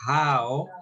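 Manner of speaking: "How" with a full ow diphthong; correct pronunciation. Shown as a demonstration. Teacher correcting students who say jo for "How", correct pronunciation. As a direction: say "How" clearly, rhyming with now. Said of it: "algunos todavía me pronuncian jo".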